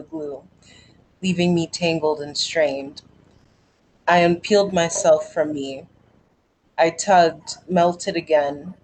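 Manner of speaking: measured spoken-word poem delivery; poem reading. Acted. Reading her poem Pulling Skin to the group, in a measured spoken-word delivery.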